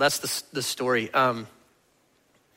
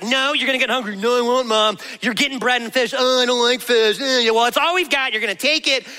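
imitating bossy mom; 8 semitones higher than usual. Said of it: "I was like".

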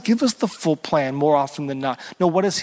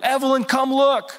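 high call to his sister; little boy calling his sister to come see, high and eager; child calling; 8 semitones higher than usual. Retold or imitated. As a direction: call out like an excited little kid. Graham calls his sister.

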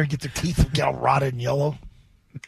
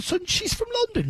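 mocking squeal; 4 dB quieter than usual. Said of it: "Oh, the accent".

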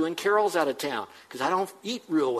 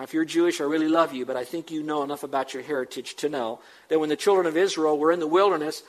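usual speaking voice

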